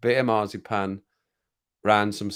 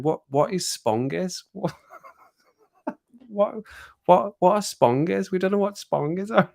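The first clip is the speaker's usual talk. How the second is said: mocking teen nieces; 9 semitones higher than usual. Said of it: "And they were going".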